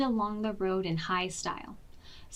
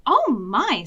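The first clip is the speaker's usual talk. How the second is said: loud startled exclamation; surprised; 3 semitones higher, 8 dB louder than usual. A narrator's reading. Granny startled by the dog's kiss, loud surprised gasp. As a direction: caught off guard by a wet kiss.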